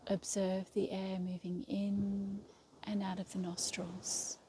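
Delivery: calm yoga nidra guide with long, restful pauses; guided meditation. Real, not acted. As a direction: lead them into stillness, one unhurried phrase at a time. Leads a yoga nidra relaxation.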